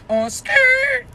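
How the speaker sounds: a high comic squeak on "On skirt"; squeaky high repeat. Real, not acted. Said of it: "my ass was on skirt".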